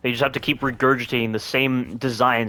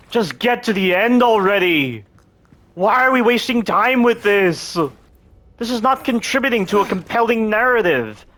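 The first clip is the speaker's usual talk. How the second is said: raised, slowed frustrated complaining; exasperated rant; 8 semitones higher than usual. Real, not acted. Ranting about wasted game time.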